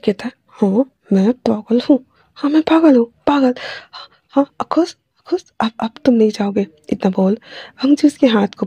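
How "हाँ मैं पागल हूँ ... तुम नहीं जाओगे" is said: manic, high-pitched repeats; crazed. A narrator's reading. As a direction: own the madness, pitch leaping up. Madman admits he is crazy, clinging.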